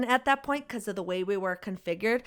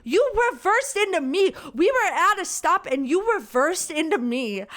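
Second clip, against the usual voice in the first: louder, higher outraged insistence; indignant; 7 semitones higher, 3 dB louder than usual. Real, not acted. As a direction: hammer home that she hit you. Indignant rant about the crash.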